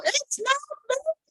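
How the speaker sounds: very high mocking whine; complaining caller. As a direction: parody of an indignant customer. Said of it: "they go".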